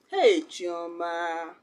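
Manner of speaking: long drawn-out, falling lament to oneself; mock self-reproach. Teacher showing talking to herself after a mishap, drawn-out mock self-reproach. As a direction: drag out the name slowly in pretend dismay. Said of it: "some things will happen to you and you'll be like".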